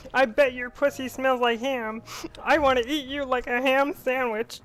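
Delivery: crude line in a puppet frog voice; put-on frog doll voice; Kermit the Frog. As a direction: do Kermit, talking dirty. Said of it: "that's my Kermit voice".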